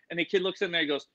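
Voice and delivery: ordinary delivery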